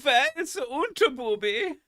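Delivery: a terrible put-on German voice, played for laughs; bad German accent. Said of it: "His German accent is terrible".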